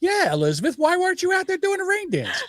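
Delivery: high, laughing jeer; laughing taunt. Real, not acted. Host laughing while taunting the senator about a rain dance.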